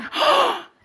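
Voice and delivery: sharp high gasp of shock. Retold or imitated. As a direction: gasp like you're scandalized. Daughters shocked at mom.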